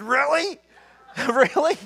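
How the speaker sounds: high, laughing mock-surprise; mother's delighted surprise. Son imitating his elderly mother, laughing and high. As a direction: squeak it out amused.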